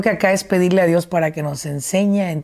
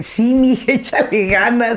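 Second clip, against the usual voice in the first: higher, louder cheery dad pep talk; host voicing the supportive dad she expected, higher and louder; mock encouraging dad; 5 semitones higher, 4 dB louder, slower than usual. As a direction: play the cheering father, hammed up.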